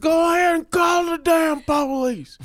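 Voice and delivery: put-on bully the old neighbor; drunk Doyle yelling. Repeating Doyle's drunk shout.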